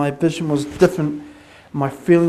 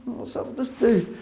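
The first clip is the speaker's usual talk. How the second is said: high, wary mock bystander voice; high, quiet baffled bystander; puzzled onlookers; 9 semitones higher, 4 dB quieter, slower than usual. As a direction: imitate people eyeing him oddly. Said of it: "People were looking at me like".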